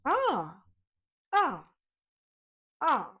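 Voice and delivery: firm glottal onset on each Ah, slightly surprised; glottal attack. Shown as a demonstration. Singing teacher demonstrating a glottal attack, firm onset. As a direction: start each Ah with a clean percussive catch. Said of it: "as if you're slightly surprised".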